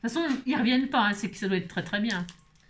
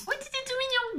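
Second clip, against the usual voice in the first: much higher, softer cooing; 14 semitones higher, 4 dB quieter than usual. Real, not acted. Cooing over cute charm. (roucoulement aigu et doux)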